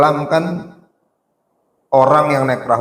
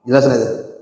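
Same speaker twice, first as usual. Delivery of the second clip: normal talking voice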